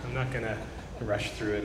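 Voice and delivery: ordinary delivery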